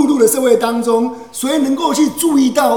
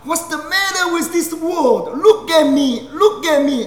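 loud cry; 4 semitones higher, slower than usual. Retold or imitated. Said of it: "他就讲一句很大声的话".

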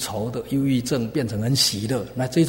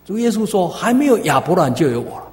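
raised, ringing scripture quote; emphatic preaching; 9 semitones higher, 8 dB louder than usual. Acted. Preacher quoting Jesus to the congregation, emphatic preaching. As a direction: proclaim it high and loud to a congregation.

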